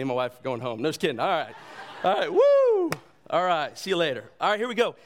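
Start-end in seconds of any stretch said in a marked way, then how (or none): 2.3-2.9 high, loud "woo"; playful whoop. Pretend. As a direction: let out a big joking whoop. Joking whoop after audience laughter.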